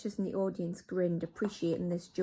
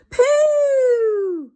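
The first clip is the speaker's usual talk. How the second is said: much higher, loud disgusted witch cry; crying Pooh; 15 dB louder than usual. Acted. Witch audience reacts to the smell, high disgusted cry. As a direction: recoil at the stink. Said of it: "cried the audience".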